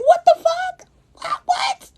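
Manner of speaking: mock outrage. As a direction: squeal it like you can't believe it. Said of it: "I'm like".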